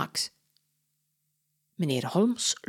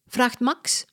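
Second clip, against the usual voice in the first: plain reading voice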